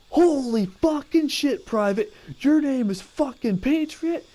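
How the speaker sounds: high, slow, in-your-face bark, imitated; drill sergeant. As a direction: chew out a recruit in disbelief. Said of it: "he went".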